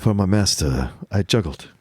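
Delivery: put-on Tony Curtis's accent. Co-host mimicking a servant's lines, low New York accent. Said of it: "I don't know where you get an accent like that".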